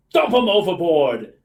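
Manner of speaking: loud, slow thug's command; hammed-up crook calling out an order; barked villain's order. A narrator's reading. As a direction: bark it like a storybook villain. Villain's order read out dramatically.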